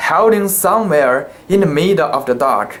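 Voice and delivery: ordinary delivery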